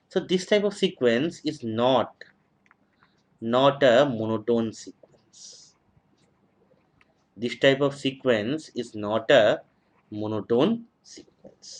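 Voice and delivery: slow dictation. Shown as a demonstration. Teacher dictating definition slowly.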